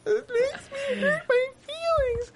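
very high fake whimper; hammed-up squeaky sobbing; mock crying. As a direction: whine as if your feelings are hurt. Pretending to be hurt by teasing.

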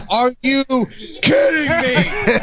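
high, drawn-out mock yell; imitated angry yell. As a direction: yell it high, stretching out the words in disbelief. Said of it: "and then he yelled".

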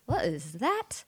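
soft, low frightened question; hushed, spooked on waking; a breathless spooked question after waking; frightened whisper. Retold or imitated. Waking from creepy dream.

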